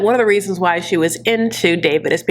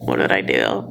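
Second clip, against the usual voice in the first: confused Lana. Retold or imitated. Lana reacting to giggling David.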